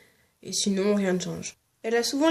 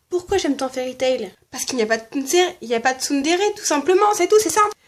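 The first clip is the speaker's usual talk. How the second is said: loud, high, furious rant; angry rant; 6 semitones higher, 5 dB louder, faster than usual. Real, not acted. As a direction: rage about tsunderes. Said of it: "Bon, allez, je me calme".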